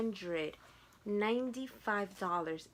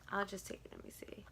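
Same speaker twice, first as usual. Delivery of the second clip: whispered aside; 10 dB quieter, faster than usual. Real, not acted. Aside while handling shoe.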